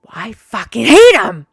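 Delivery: explodes loud with a shout on hate; furious outburst. Retold or imitated. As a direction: blurt it out in a roar of anger. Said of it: "And I'm like this".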